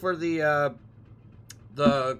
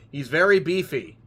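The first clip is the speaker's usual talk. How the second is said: ordinary delivery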